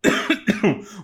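sudden cough from choking on a drink. Real, not acted. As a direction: cough as if something went down wrong. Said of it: "Tô, me engasguei".

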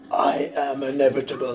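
hammed-up raspy menace; Josh Brolin. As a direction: get really raspy and portentous. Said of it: "my Josh Brolin impersonation".

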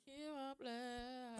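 drawn-out, soft church voice; slow mock blessing. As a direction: stretch it out slowly.

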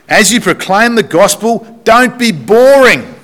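high, hammed-up plea; playful mock warning. Playful paraphrase of verse.

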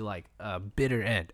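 usual speaking voice

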